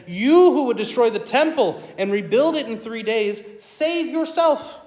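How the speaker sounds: mock him from below the cross; passersby taunting Jesus on the cross; deriding taunt. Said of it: "derided him".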